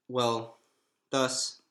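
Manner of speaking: ordinary delivery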